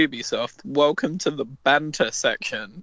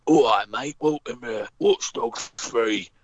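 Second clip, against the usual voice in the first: a lightly done lower Cockney accent, slow on the welcome; 4 semitones lower than usual. Shown as a demonstration. Mocking a game trailer's Cockney greeting in a lower Cockney accent. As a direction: greet them like a Cockney geezer, low and slow. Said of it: "aggressively Cockney accent".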